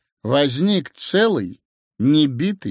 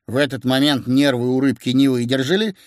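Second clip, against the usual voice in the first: ordinary delivery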